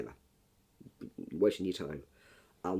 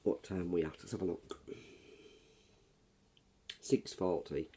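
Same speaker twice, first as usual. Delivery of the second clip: croaky morning voice; hoarse, just woken up; frog in throat; 10 dB louder than usual. Real, not acted. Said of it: "bit of a frog in my throat".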